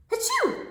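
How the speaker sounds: high-pitched pretend achoo; sudden squeaky-high fake sneeze; mock sneeze. Storyteller acting out the cow's sneeze, high mock achoo. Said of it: "the cow sneezed".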